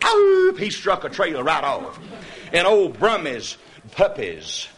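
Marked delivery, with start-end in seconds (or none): 0.1-0.6 high sharp oop calling the dogs; hound call. Retold or imitated. Hunter whooping at the hounds, high sharp call.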